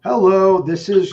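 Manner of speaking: bright, loud sales hello; phone greeting voice. Real, not acted. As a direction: greet the caller with high energy. Cold call phone greeting.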